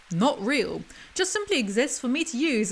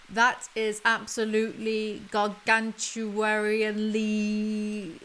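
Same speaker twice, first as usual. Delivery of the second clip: slow pompous reading; much slower than usual. Acted. Reading own book pompously.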